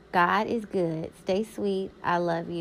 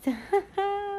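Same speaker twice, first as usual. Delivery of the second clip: high laugh; 13 semitones higher than usual. Real, not acted. Laugh after wedding joke.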